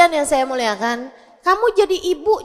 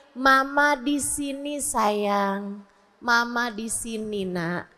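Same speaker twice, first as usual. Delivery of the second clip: soft, slow, tender reassurance; calm a crying child gently and unhurriedly; soothing mother; 4 semitones lower, 7 dB quieter, much slower than usual. Acted. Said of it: "kasih ketenangan".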